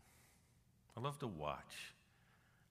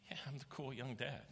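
smug cool dad; 7 semitones higher than usual. Retold or imitated. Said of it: "And I went".

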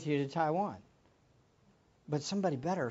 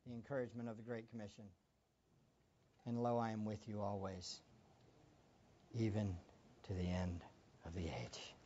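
soft, low scripture reading; quiet reverent reading; 5 semitones lower, 12 dB quieter than usual. Real, not acted. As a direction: drop to a hush for the promise.

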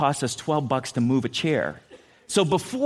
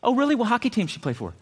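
mock innocent; 8 semitones higher, faster than usual. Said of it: "The kid goes".

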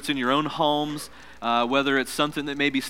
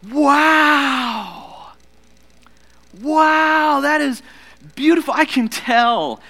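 high, delighted mother's wow; awed mom; 9 semitones higher, 5 dB louder, much slower than usual. Retold or imitated. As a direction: marvel at it like a proud mom. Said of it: "She goes".

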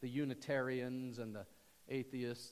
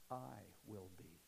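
hushed; 4 semitones lower, 11 dB quieter than usual. Acted. Speaking as Jesus, hushed.

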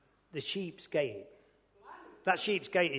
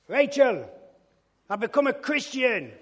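loud, high call up the stairs; shouting upstairs; 9 semitones higher, 9 dB louder, slower than usual. Retold or imitated. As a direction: shout it up to the wife. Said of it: "I shouts up the stairs".

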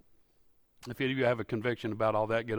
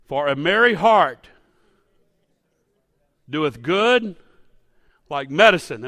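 slow, loud preached verse; full-voiced slow proclamation of "merry heart"; preaching loud; 3 semitones higher, 10 dB louder, slower than usual. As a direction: proclaim it to the back row, slowly. Scripture repeated at full preaching force.

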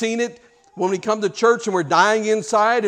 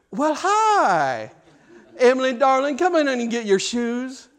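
higher, honeyed mother's voice; sweet sugary tone; 6 semitones higher than usual. Retold or imitated. Mother sweetly calls to her daughter as neighbor watches. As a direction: switch instantly to sweetness for the neighbor. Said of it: "just went from ... to".

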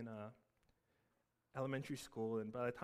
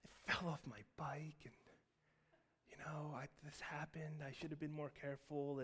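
soft, whispered, rehearsed lie; hushed excuse to mom; faked innocence; 2 semitones higher, 5 dB quieter than usual. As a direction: play the innocent boy hiding a beating. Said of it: "convincing performance that deserved an actor".

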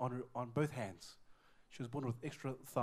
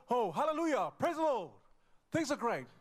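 high, louder mock-jubilant praise; happy praise; 9 semitones higher, 5 dB louder than usual. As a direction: exclaim it like an elated believer. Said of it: "When things are good".

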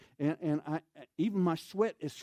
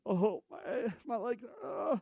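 exhausted groan; 6 semitones higher, slower than usual. Retold or imitated. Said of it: "this box going".

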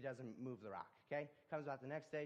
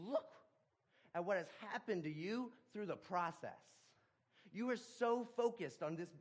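preaching higher and louder, driving each phrase; full-force preaching; 7 semitones higher, 6 dB louder than usual. Acted. God tells the discouraged man what he missed, preached at full force. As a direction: press the lesson home from the pulpit.

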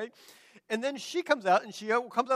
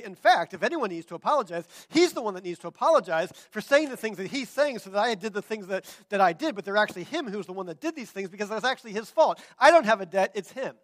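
loud, quick, insistent self-defence; indignant blame-shifting; 9 dB louder than usual. Retold or imitated. Girlfriend saying he needs to apologize, loudly indignant. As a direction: pile up the accusations emphatically.

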